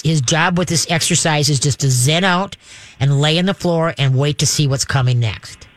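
ordinary talk

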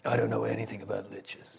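hushed, unvoiced admission; whispered confession. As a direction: whisper it like a guilty secret. Confessing ignorance to the party.

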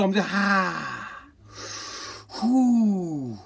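exaggerated deep-breath calm; mock calming down. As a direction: talk yourself down, sarcastically. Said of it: "I said".

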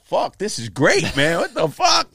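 remembering the thrill, yelling it out; excited outburst. Retold or imitated. Retold joy at getting paid.

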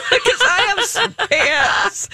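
laughing. Real, not acted. Laughing at her own offer.

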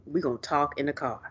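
low, soft, pointed warning; understated mock threat; quiet stern warning. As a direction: mutter it like a parent in public. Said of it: "Remind me".

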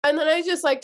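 ordinary delivery

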